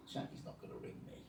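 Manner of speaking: hushed, doubting inner thought; very soft muttered thought to yourself; doubtful aside. Retold or imitated. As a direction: say it under your breath, disbelieving. Retells his private doubting thought.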